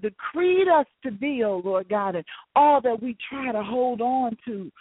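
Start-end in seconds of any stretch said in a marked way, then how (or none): none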